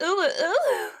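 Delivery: high squeaky anime coo; cutesy ooh. Shown as a demonstration. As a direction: coo it cutely. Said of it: "I don't know what that thing is".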